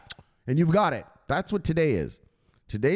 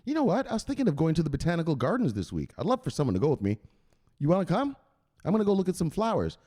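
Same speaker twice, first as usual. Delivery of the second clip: quick, higher casual pitch; smooth invitation; 4 semitones higher, faster than usual. Acted. Suitor inviting Teresa to the gardens, quick light invitation. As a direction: slip the date in lightly. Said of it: "you say something like".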